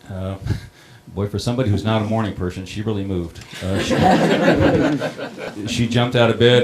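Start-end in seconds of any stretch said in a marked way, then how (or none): 3.6-6.6 higher, louder, laughing through the words; amused chuckle mid-story. Real, not acted. Recalling his wife leaping out of bed, laughing.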